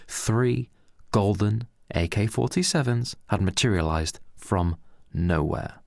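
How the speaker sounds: plain reading voice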